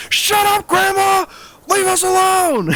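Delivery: high whiny brat screaming at grandma; high, bratty shriek; bratty kid yelling. Retold or imitated. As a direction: yell it like a spoiled kid.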